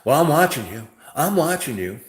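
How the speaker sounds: hammed-up I'm-watching-you threat; mock loud threat. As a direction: shout a warning at the strangers. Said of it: "she goes".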